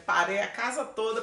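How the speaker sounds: ordinary talk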